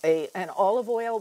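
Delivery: ordinary talk, nothing marked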